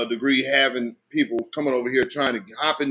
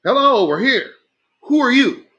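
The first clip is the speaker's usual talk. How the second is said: higher, louder pointed hail; mock calling out; 8 semitones higher, 4 dB louder than usual. Demanding who they are from evasive people, mock calling out. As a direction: call out for an answer, pitched up.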